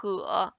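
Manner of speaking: "he" low, dipping then rising; third tone. Shown as a demonstration. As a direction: say Mandarin "he" down low and back up. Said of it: "we have four tones in Chinese".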